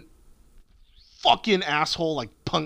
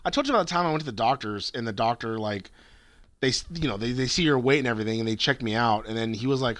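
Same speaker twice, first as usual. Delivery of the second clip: ordinary talk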